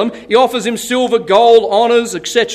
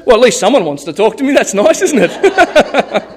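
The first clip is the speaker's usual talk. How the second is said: lightly voiced lonely soul. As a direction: play someone glad of any company, breaking into a laugh. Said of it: "some of us would say".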